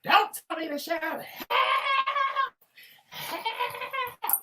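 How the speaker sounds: high, loud mock screaming for help; imitating husband screaming help, half laughing; mock yell. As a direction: yell it like a man losing it, laughing. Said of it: "screaming at the top of his lungs".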